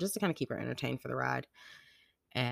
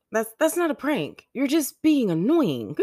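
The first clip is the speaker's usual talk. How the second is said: high, loud retold exasperation; exasperated mom; 12 semitones higher, 8 dB louder than usual. Mom telling kid that's not a prank, exasperated. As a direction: protest higher and louder, drawing out the end.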